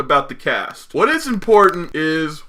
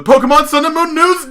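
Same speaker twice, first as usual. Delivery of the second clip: segment announcer; 11 semitones higher, 5 dB louder, faster than usual. Pretend. Announcing the next podcast segment.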